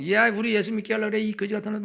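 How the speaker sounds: high, loud imitation of a heckler; mock heckling. Gangster disrupting the mission meeting, heckling. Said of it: "막 욕하고 그러다가".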